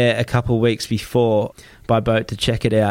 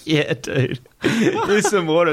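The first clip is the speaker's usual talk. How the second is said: high, laughing dude voice, slowed; laughing quote; 6 semitones higher, much slower than usual. Retold or imitated. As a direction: laugh through the beach guy's easygoing reply. Retelling islanders handing water.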